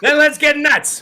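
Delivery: quoting the 1989 movie to the chat, hammed up; movie villain quote. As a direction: bellow it like the unhinged film line. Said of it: "What movie was that?".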